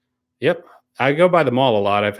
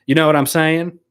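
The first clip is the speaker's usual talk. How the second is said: a quick Southern accent on request; exaggerated Southern accent; 6 semitones higher, 3 dB louder than usual. Shown as a demonstration. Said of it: "with exaggerated Southern accent".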